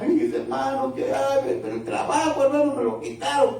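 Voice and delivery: high, fast lamenting voice; hammed-up complaining sister; whiny church sister. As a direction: imitate a sister wailing about her lost job.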